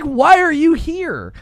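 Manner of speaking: a clerk staring you down; hammed-up disbelief; mock disbelief. Voicing disbelieving game.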